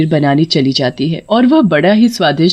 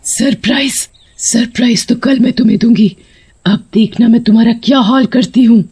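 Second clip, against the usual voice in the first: vengeful threat in a raised voice; furious witch; 5 semitones higher, 3 dB louder than usual. Acted. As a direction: seethe and promise revenge. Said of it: "सुनकर आग बबूला हो जाती है". (गुस्से से धमकाते हुए)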